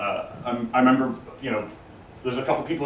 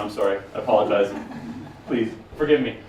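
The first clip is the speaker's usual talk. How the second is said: usual speaking voice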